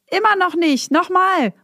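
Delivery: put-on high-pitched kids' call, voice thrown out; children calling out. Granny hears kids shouting outside, imitated high-pitched call. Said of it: "rufen gehört hat".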